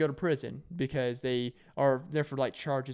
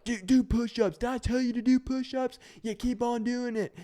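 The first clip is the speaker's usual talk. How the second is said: drill sergeant orders; 10 semitones higher than usual. Retold or imitated. Sergeant ordering the kid to do push-ups, shouted. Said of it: "They're like".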